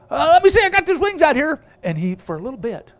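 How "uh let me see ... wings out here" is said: high, loud chick voice; eaglet trying. Acted. Eaglet talking himself through his first glide, high and loud. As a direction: squeak it with nervous effort.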